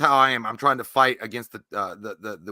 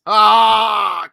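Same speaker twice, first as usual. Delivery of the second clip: anguished shout; 8 semitones higher, 13 dB louder than usual. Real, not acted. Reacts with a cry to Shenanigans.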